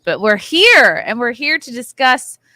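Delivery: normal talking voice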